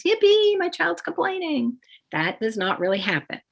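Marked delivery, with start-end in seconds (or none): 0.0-1.8 high, hammed-up cheer; mock glee. Parent pretending to celebrate child's complaint, high mock glee. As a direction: squeal it like fake delight. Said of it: "No one really thinks".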